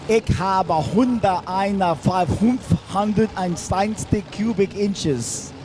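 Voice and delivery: slow, hammed-up German accent; mock German accent. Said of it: "don't speak German".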